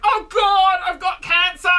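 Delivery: high, loud, mock panic; panicked cry. Hypochondriac over a sore arm, a hammed-up panicked shout. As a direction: shout like you're sure it's cancer. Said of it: "you're like".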